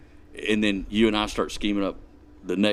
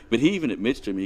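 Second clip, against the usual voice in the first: ordinary talk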